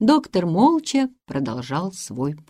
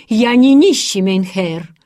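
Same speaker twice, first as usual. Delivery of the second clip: proud, louder retort; 9 dB louder than usual. A narrator's reading. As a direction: stand up for yourself proudly. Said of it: "гордо возразил Ханс".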